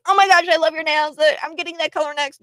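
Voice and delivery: high, fast, gushing mock voice; mock gushing stranger. Mocking a stranger who raves about her nails, high and fast. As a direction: rattle it off high and over-eager. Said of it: "get into my face and say".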